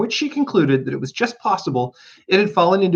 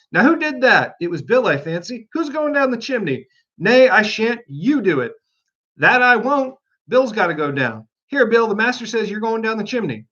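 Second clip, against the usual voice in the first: animals arguing; 7 semitones higher than usual. Acted.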